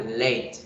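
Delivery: "late" as one beat, leyt; one syllable. Shown as a demonstration. Teacher showing syllable count, one-syllable "late". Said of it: "Se pronuncia de una sola vez".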